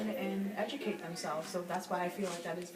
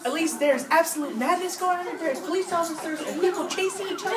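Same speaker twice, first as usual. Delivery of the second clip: excited; 10 semitones higher, 10 dB louder than usual. Acted. Frantic reporter.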